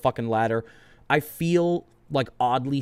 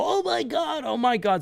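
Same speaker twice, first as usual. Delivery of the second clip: mock yelling; 11 semitones higher, 3 dB quieter than usual. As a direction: imitate his yelling in a high, restrained voice. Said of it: "started yelling and go".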